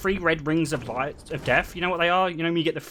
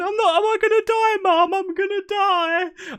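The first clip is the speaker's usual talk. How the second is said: very high, wailing imitation of a sister; mock crying; 3 dB louder than usual. Crying sister to mum at the piercing, squealed mock wail. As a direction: squeal it like a hammed-up terrified sob. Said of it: "she's, like, crying".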